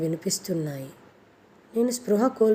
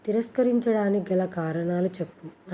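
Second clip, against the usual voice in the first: usual speaking voice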